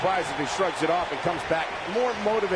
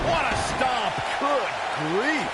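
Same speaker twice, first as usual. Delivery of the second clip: wrestling commentator; 4 semitones higher than usual. Acted. Ringside play-by-play calls.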